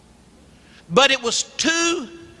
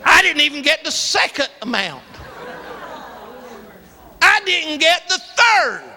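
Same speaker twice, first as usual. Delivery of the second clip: ordinary talk, nothing marked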